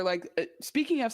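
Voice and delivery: ordinary talk, nothing marked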